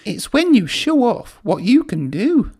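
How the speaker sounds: put-on young engine voice. A narrator's reading. Thomas explaining the word to Percy, high and bright. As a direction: spell it out like a bright little engine. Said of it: "said Thomas".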